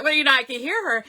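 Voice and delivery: normal talking voice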